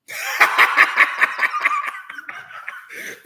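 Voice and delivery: loud, high staccato laugh. Real, not acted. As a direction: burst out in a rapid, high laugh. Speaker laughs between remarks.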